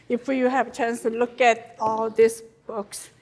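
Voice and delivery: ordinary delivery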